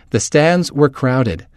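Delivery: ordinary delivery, nothing marked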